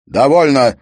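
booming shout to stop everything; thundering command. A narrator's reading. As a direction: thunder it across a hall. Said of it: "прогремел его голос".

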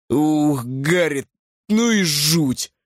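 slow, drawn-out creature voice; groll's character voice. A narrator's reading. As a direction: voice a groll, dragging the words out. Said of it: "сказал Гроль".

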